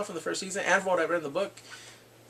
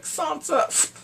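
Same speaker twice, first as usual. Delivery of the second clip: pleading Sansa; 12 semitones higher, 4 dB louder than usual. Retold or imitated.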